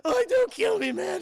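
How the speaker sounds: high, loud mock-terrified scream; screamed begging. Shown as a demonstration. Showing chat he can play henchman, screamed begging. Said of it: "I could scream".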